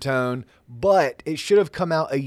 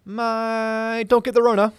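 higher, louder playful warning; emphatic catchphrase; 8 semitones higher, 4 dB louder than usual. Acted. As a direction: punch out the catchphrase.

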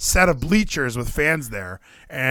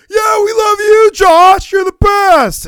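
much higher and louder, a hollering fan; drunk fan yelling; 11 dB louder, slower than usual. Retold or imitated. Mimicking a drunk audience member yelling at a wrestler, loud holler. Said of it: "an audience member going".

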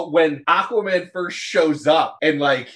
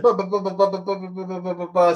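rapid-fire nonsense babble; imitated rambling; 3 semitones higher, faster than usual. Imitating Barry fanboying at Batman, rambling a mile a minute. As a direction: rattle off syllables without a break. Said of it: "Barry is talking a mile a minute".

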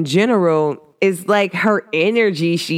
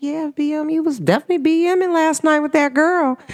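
teasing; 11 semitones higher than usual. Retold or imitated. Teasing her friend about last night, high and playful. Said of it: "I was like".

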